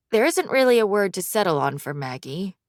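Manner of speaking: usual speaking voice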